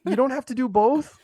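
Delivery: exasperated. Real, not acted. Mocking the obituary wording.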